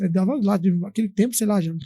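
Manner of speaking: ordinary talk, nothing marked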